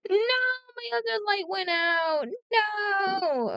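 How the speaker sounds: long high-pitched drawn-out cry of despair; dismayed wail. Real, not acted. Painter to viewers as a second light dies, dismayed wail. Light fails during filming.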